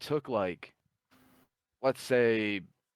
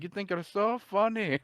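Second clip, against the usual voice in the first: much higher, indignant challenge; mock-furious, pitched way up; pissed off; 12 semitones higher, 3 dB louder than usual. Scammer confronting the prankster who fooled him, pissed off. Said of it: "just pissed off, losing his mind".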